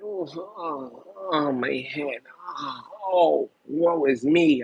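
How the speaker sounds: mock diva sighing woe; hammed-up fussy lament; dramatic sigh. Mocking fussy diva women.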